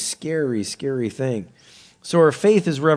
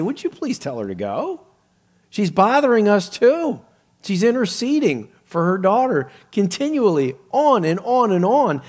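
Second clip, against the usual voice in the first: high, raised-voice pleading to be rid of her; acted fed-up disciples; exasperated complaint; 7 semitones higher, 4 dB louder than usual. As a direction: complain insistently, punching the words. Disciples complain about the woman.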